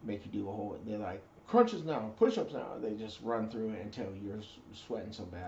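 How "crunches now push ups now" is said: louder, higher barked orders; mock drill orders. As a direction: shout the exercises. Drill instructors smoking recruits.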